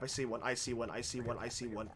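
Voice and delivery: low and very soft; hushed alert. Real, not acted. Gamer spotting an enemy, hushed. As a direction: whisper the sighting.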